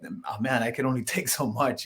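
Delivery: ordinary talk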